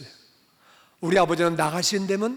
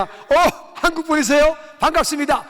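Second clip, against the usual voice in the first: high, louder glad greeting; an old man thrilled to find a countryman; delighted surprise at meeting a fellow Korean; surprised delight; 10 semitones higher, 4 dB louder than usual. Retold or imitated.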